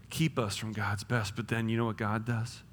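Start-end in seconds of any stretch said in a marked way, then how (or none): none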